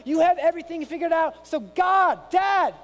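high, loud, impassioned plea to God; welling-up cry. Acted. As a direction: cry out like a helpless child to a father. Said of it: "Is there a cry welling up inside".

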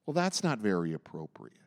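low, prim church-person scolding; disapproving church person. Acted. Church person sitting arms crossed inside us, disapproving. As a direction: lean back and disapprove in a low, falling voice. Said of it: "looks like a church person".

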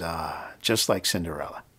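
low, soft, mock-dreamy quote; a touch of hushed wonder, lightly imitated; softly delighted sigh. Grateful man voiced in story.

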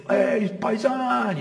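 hammed-up Italian-American "paisan"; mock Italian-American. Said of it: "Or call an Italian guy".

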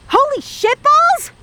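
very high, slow shriek; panicked scream. Acted. Reacting to a sudden shock, high panicked shriek. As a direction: screech it in shock.

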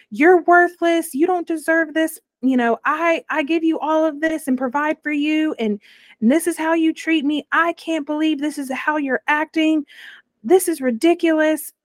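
retold angry rant. Ex-fiance who burst into the bedroom over a slammed door, mock angry rant.

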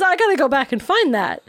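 amused, laugh breaking into the words. Real, not acted. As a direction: say it while cracking up. Laughing over a misheard word.

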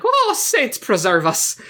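slow, high, hammed-up Irish "Oh, saints preserve us"; mock Irish exclamation. Quoting the movie's cheesy line in a mock Irish accent. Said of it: "lets out the iconic".

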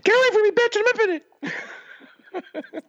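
high squeaky yelled line; Chappelle impression. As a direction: squeal it out, hammed-up. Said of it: "I hope you're doing Chappelle".

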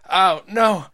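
loud, high hurt cry; pained yell. Acted. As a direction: yell in pain. Boy hurt.

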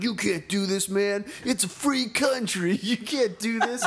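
tipsy, high-pitched indignant protest; mock-righteous drunk college kid. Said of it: "had a little bit of liquid courage and was like".

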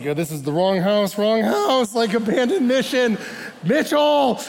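hammed-up alarm, urging his partner away; mock panic. The nervous missionary begs Mitchell to leave, in mock panic. Other missionary wants to flee.

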